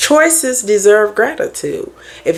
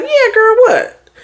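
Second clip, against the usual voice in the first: put-on "Yeah, girl, what?" much higher and bright with friendly lift; playful high greeting to a girlfriend; 4 dB louder, slower than usual. Shown as a demonstration. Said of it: "Like that".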